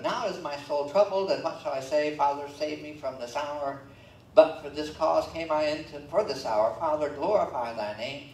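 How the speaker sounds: loud, ringing scripture reading; preaching scripture. Acted. Preacher reading Jesus to the congregation, loud and ringing. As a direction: proclaim it from the pulpit.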